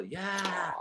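high, soft mock cheer; drawn-out, high-pitched pretend cheer; imitated kid's cheer. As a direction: mimic an excited kid, small and high. Voicing kid running down aisle.

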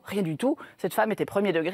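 ordinary talk, nothing marked

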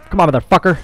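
high, agitated urging; heated urging. Real, not acted. Player cursing an enemy mid-fight, heated urging. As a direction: push it up in pitch, frustrated. Urging grows higher in firefight.

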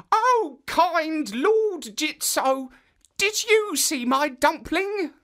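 put-on high, slow little old woman pleading; a tiny old woman asking kindly. Old woman asking the statue about her dumpling, high and slow.

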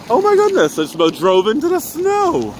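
real shock after nearly crashing; startled gasp. Real, not acted.

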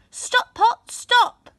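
put-on old woman commanding the pot to stop; commanding spell. A narrator's reading. Magic words stop the pot.

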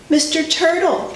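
usual speaking voice

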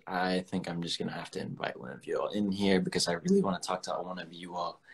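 ordinary talk, nothing marked

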